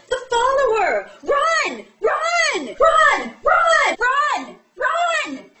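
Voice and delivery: high, loud hammed-up alarm; panicked shouting. Atrium spots a pursuer and warns Covenant, mock-panicked shouting. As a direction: shriek the warning over and over.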